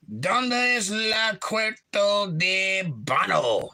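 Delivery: high, drawn-out mock Spanish; hammed-up Spanish. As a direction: stretch out the clumsy Spanish question. Hammed-up attempt at Spanish question.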